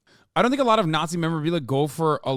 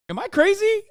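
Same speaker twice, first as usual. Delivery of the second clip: laughing disbelief. Real, not acted.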